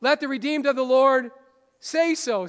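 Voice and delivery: declared like a rallying call; loud quote. Shown as a demonstration. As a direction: proclaim it to the room. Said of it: "What does it say?".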